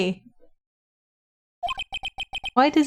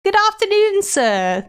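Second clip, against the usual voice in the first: fawning hotel bellboy, voice raised high; polite bellboy; 10 semitones higher than usual. Acted. Bellboy character voice.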